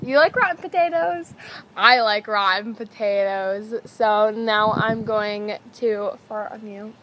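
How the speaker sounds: ordinary talk, nothing marked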